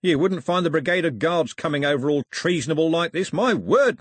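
high, indignant grievance; complaint. A narrator's reading. Cox grumbles about treasonable behaviour, high complaining. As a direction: keep complaining, rising on the exclamation. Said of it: "he complained".

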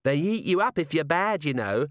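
put-on high little-girl voice with relish; small girl, approving. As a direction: inform them primly, with approval. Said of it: "said a small girl with obvious approval".

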